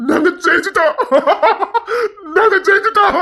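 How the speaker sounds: high, laughing and crying at once; tearful laughing triumph; laughing through tears. Acted. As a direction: cry out the win in joy. Family celebrates birth.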